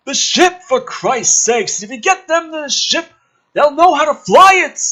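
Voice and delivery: high, loud hammed-up yelling; mock shout. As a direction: bellow it like a panicked officer. Shouted script line read aloud.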